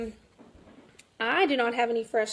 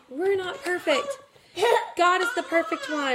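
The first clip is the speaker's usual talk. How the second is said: laughing; 7 semitones higher than usual. Real, not acted. Quoting her advice while laughing.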